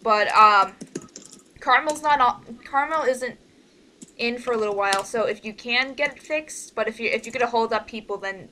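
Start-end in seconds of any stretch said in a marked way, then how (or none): none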